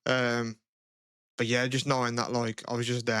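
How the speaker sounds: ordinary talk, nothing marked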